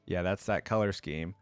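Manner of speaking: ordinary talk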